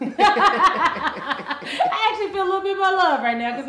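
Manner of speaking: laughing while speaking. Real, not acted. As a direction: laugh your way through it.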